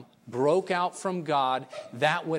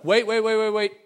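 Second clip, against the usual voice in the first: urgent interruption; 7 semitones higher, 8 dB louder than usual. Retold or imitated. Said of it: "One of the Yembis stood up".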